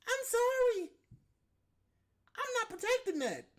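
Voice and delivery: like you're begging someone to just be decent; exasperated outburst. Acted. To a rude worker behind the counter, a high exasperated outburst.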